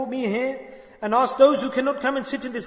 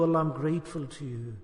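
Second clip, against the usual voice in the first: soft inner thanks; 8 semitones lower, 8 dB quieter than usual. Shown as a demonstration. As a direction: lower and much softer, almost inward. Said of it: "You have to say this".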